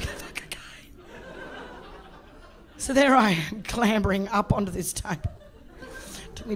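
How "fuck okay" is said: breathless whispered giving in; whispered resignation. Retold or imitated. Client gives in to the masseuse, whispered. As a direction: whisper it, cowed. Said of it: "I was like".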